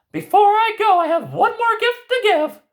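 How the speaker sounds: high fairy-tale granny voice; grandmother voice. Acted. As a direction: play the knowing old grandmother. Grandmother character voice.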